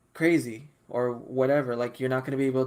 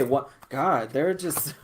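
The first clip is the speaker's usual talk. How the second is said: usual speaking voice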